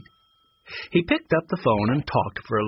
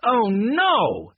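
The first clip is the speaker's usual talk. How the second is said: dismayed; 10 semitones higher, 3 dB louder than usual. A narrator's reading. Tate caught by Monica.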